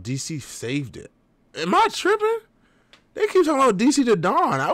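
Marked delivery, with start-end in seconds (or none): 1.5-4.6 high, disbelieving am I tripping; baffled at what everyone says; incredulous. Real, not acted. Disbelief at comments.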